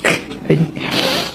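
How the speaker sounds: short soft unvoiced laugh; breathy laugh. Real, not acted. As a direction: laugh under the breath at the absurdity. Vlogger mocks the quarrel. (tawa kecil tertahan tanpa suara)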